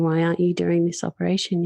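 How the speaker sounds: usual speaking voice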